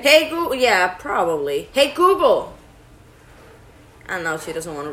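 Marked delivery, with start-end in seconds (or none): 0.0-0.5 loud high call to the device. Real, not acted. Calling the voice assistant. 1.7-2.5 loud high call to the device. Real, not acted. Calling the voice assistant.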